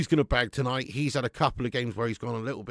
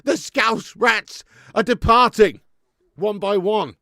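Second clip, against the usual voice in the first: mock dramatic gloat; 6 semitones higher, 7 dB louder, slower than usual. Gloating over Liverpool players leaving.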